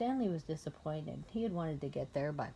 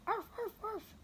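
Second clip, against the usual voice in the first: dog barking; 3 dB quieter than usual. A narrator's reading.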